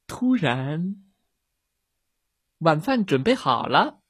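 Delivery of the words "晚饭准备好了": mother bear calling home, lightly raised. A narrator's reading. Mother bear calls her cub to dinner, calling out. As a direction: call the cubs home for dinner. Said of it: "熊妈妈招呼着".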